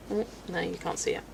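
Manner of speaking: ordinary talk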